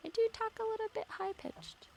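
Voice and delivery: high-pitched voice. Shown as a demonstration. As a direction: lift it way up into a squeaky-high register. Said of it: "I have a high-pitched voice".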